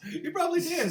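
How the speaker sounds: laughing. Real, not acted. Laughing high after joke.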